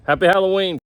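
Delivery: loud, high festive greeting; cheerful greeting. Real, not acted. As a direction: wish everyone a happy Halloween.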